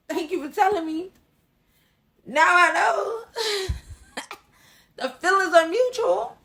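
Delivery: high, loud mock-grateful delivery with laughs; gleeful sarcasm. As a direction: thank the hater sweetly, laughing through it. Sarcastic thanks to a hater, laughing.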